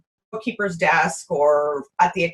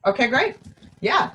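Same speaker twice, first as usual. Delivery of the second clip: ordinary talk, nothing marked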